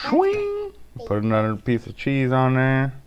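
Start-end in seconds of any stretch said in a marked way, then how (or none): 0.1-0.7 high, playful metallic swish; slicer sound effect. Pretend. Dad playing with a cheese slicer, high swish sound effect. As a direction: voice the blade slicing through.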